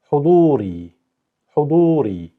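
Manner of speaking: huduri with long du and ri; model pronunciation. Shown as a demonstration. Teacher modelling a word for learners, careful. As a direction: stretch du and ri in huduri. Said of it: "going to sound like huduri".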